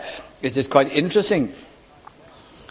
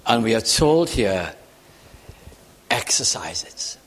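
slow down and sink almost to a whisper by the end; hushed reverent preaching; 5 semitones lower than usual. Real, not acted. Preacher telling the congregation of glorious joy, hushed and reverent.

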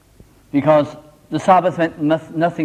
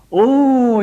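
a high, loud surprised oh; retelling the workman's surprise, louder and higher; 8 semitones higher, 5 dB louder than usual. Retold or imitated. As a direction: catch him off guard, high and bright. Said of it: "he said".

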